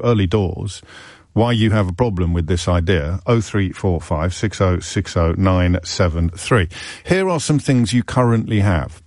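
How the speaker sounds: ordinary talk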